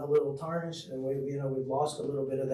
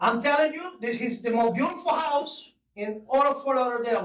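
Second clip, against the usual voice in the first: much higher, laughing Spanish accent sales pitch; 8 semitones higher than usual. Shown as a demonstration. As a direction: sell the house in a hammed-up Spanish accent. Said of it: "Let's hear it".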